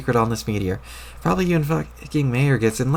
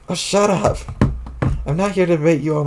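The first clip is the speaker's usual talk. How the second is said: annoyed shout; 5 semitones higher than usual. Acted. Annoyed outburst.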